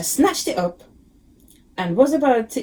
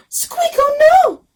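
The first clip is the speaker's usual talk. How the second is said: high, slow crying squeak of alarm; a little mouse wails in dismay; squeaky yell; 7 dB louder, much slower than usual. A narrator's reading. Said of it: "Mister Mouse yelled".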